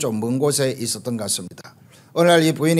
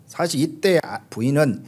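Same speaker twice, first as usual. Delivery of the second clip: ordinary talk, nothing marked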